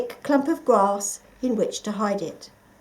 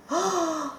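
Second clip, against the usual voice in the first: horrified gasp; 8 semitones higher than usual. A narrator's reading. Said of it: "to his horror".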